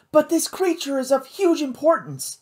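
high, firm insistence; insisting. A narrator's reading. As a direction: Kate insists to Matt, pitched high and firm. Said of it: "she insisted".

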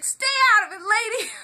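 high, loud yell back; imitated boys yelling; mock teen shout. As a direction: shout at the lady to butt out. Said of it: "They're like".

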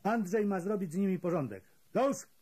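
fast, rising to a sharp shout on Już; barked order. A narrator's reading. Kapo ordering Andrzej to deal with them, fast and loud. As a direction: bark the command, then explode.

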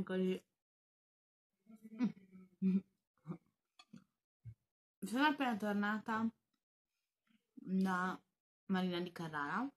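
chewing while talking; speaking around food; mouth full. Real, not acted. Seen 2: Bites bread at -5; cheeks bulge, chewing while speaking throughout.

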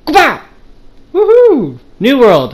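high, loud cheer; excited whoop. Real, not acted. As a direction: shout it with joy. Jumping into the water.

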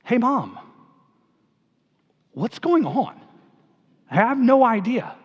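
imitating a clueless son, with long pauses; at a loss. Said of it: "I don't know what to say".

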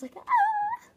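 very high delighted squeal; cute squeal. Real, not acted. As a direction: squeal over the cuteness. Squeal over cute toy.

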